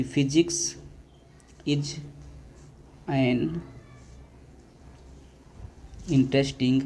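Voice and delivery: "is" read with long pauses between words; slow dictation. Shown as a demonstration. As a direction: dictate the corrected sentence word by word. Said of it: "सही करके इसको आप ऐसे लिखेंगे". (रुक-रुक कर सही वाक्य लिखवाना)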